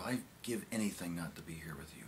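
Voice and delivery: low, soft, near-whispered admission; hushed confession. Acted. Speaker confesses to an old colleague, hushed. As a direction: let it sink away quietly.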